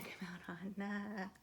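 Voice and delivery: whispered aside to herself; half-whispered joking aside. Real, not acted. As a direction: breathe it under the breath. Aside about the devil card.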